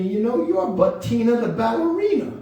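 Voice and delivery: high puppet voice quoting mom; Bettina. Pretend. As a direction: proudly announce it as a puppet character. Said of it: "my mom said to me".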